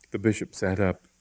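ordinary delivery

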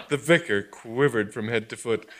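ordinary delivery

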